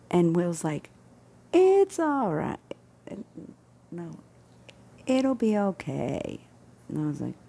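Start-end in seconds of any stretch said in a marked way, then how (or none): 1.5-6.4 slow, high mock reassurance; mock dismissive Will. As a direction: drag it out like a lazy brush-off. Mimicking coworker Will's dismissal.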